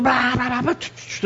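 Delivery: high-pitched imitation of a quarrel; mock arguing babble. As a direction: fire off nonsense syllables like a spat. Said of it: "acribillándonos".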